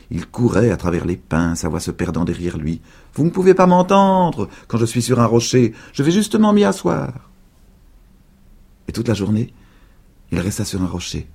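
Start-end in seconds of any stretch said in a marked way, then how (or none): 3.1-7.3 put-on higher boy's taunting voice. A narrator's reading. Invisible boy taunting old woman, higher boyish voice. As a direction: tease her from hiding.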